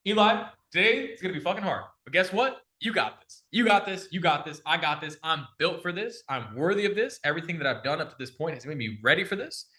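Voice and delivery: a self hype-up, talking to himself in the mirror; pumping himself up. Retold or imitated. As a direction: psych yourself up for a hard day. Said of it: "I'm literally walking around like".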